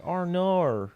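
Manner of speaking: high, rolled-out Kiwi "R nar"; Kiwi accent. Shown as a demonstration. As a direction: say "R nar" like a New Zealander.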